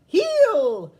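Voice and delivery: very loud, high shouted command; dog trainer yelling heel; cried out. Acted. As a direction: bark the dog command across the park. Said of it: "cried the leader".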